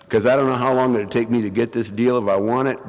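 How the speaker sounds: usual speaking voice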